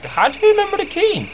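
very high, squeaky put-on question; mock astonished voice. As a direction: squeak it out in cartoon disbelief. Acts out own surprised question.

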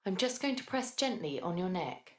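ordinary talk, nothing marked

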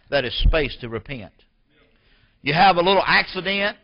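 loud, high-pitched sermon delivery; full-force preaching. Real, not acted. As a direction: preach it hard, driving each phrase out.

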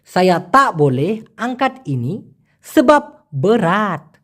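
"সায়া তা বলে আংকাত ইনি সেবাব বেরাত" spoken slowly, word by word; slow Malay demo. Shown as a demonstration. Teacher to Bangla learners, slow careful Malay sentence. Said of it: "মালয়েশিয়ানরা ঠিক এভাবেই বলে".

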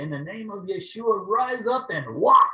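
commanding shout. Pretend. What he wanted to shout at the woman, booming command. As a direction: order her out of the car.

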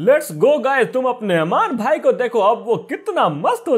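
high, hyped-up celebration; excited boasting. Acted. Gamer showing viewers his new outfit, excited boasting.

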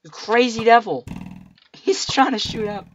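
loud, high startled shouting; excited yell. Real, not acted. Gamer shot at by a skeleton, loud excited yelling. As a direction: yell in alarm at the attack.

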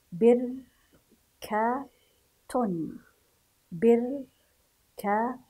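"بركة" very slowly, split by pauses into bir, ka, tun; syllable by syllable. Shown as a demonstration. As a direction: sound out "بركة" in separate syllables. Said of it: "هكذا نقرأ الحروف". (تهجئة "بركة" ببطء مقطعًا مقطعًا)

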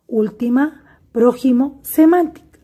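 última, prójimo, semántica, stressed on úl, pró and mán; antepenultimate stress. Shown as a demonstration. Teacher gives examples of esdrújulas, antepenultimate stress. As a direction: list última, prójimo, semántica, weight third syllable from the end. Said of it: "se acentúan en la antepenúltima sílaba".

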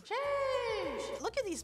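high, stretched sheesh at herself; hammed-up admiring gasp; a playful gush over her own abs; mock amazed sheesh.

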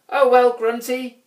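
high troll voice, resigned; sighing little troll. A narrator's reading. Grouch packs up to leave his friend, high resigned sigh. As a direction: sigh it out high, starting loud. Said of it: "he sighed".

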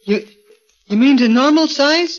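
can't believe your ears; astonished hope. Acted. Gorgo hears he might grow, high and excited.

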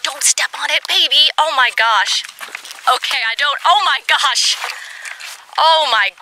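high, loud alarmed cry to the dog. Real, not acted. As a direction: panic as the dog nears the snake. Alarm as dog nears snake.

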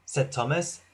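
usual speaking voice

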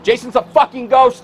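angry. Acted. Angry confrontation with Tommy.

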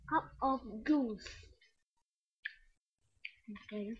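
talking around sweet; chewing while talking. Real, not acted. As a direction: mouth partly full.

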